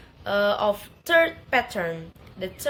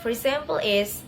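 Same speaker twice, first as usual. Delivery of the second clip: usual speaking voice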